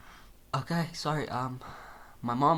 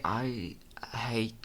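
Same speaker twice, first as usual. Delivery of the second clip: whispered; 6 dB quieter than usual. Acted.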